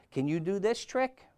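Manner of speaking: high-pitched showing-off question; crying out. A narrator's reading. Toad on the wire to Frog, high boastful call. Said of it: "cried Toad".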